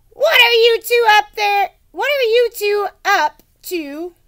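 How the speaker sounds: put-on mother shouts at kids from inside the hut; shouted from inside. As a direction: call out from the hut. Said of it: "let me try that again".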